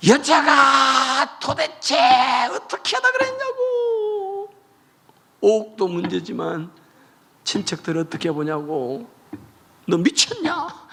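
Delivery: husband screaming at his wife over the vase; furious husband. Retold or imitated. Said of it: "막 화를 내고 욕을 퍼붓고". (화내며 고함치는 남편)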